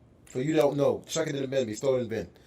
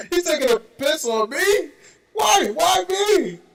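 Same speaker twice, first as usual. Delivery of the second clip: high, loud hammed-up dismay; mock outrage; 11 semitones higher, 5 dB louder, slower than usual. Host playing wronged by teasing friends, high and loud. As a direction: throw your hands up, playfully wronged. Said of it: "mock outrage at being teased".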